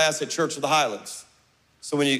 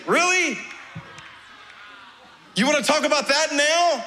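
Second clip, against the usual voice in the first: really, now of all times?; a fed-up dad shouting back; exasperated outburst; 8 semitones higher than usual. Retold or imitated. Exasperated father answering.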